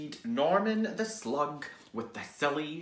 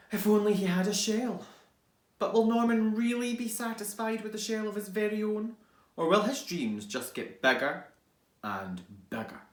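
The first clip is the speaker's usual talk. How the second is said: blurb read; 4 semitones higher than usual. Acted. Reading the blurb dramatically.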